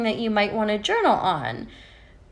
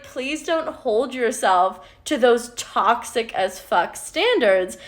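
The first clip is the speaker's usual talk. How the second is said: normal talking voice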